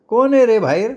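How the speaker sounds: high-pitched call from indoors; querying old woman. Acted. Old woman asks who is outside the hut, querying.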